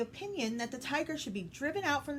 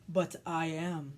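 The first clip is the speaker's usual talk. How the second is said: tiger voice; 3 semitones lower, slower than usual. Acted. Tiger speaks in story.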